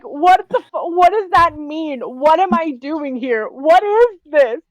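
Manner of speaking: high, drawn-out baffled questions; utterly confused. Acted. Confused character turning back to the professor, bewildered. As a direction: stretch the last questions in mock bewilderment. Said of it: "absolutely confused out of my mind".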